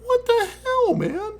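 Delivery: high incredulous squeak; stunned, high outrage; what the hell. Retold or imitated. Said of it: "And I'm thinking".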